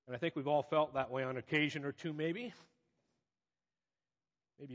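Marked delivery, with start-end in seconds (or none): none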